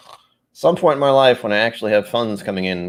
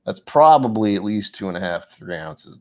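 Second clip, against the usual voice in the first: normal talking voice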